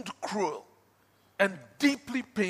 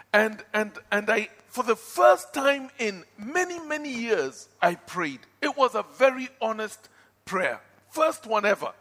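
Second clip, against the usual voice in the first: ordinary talk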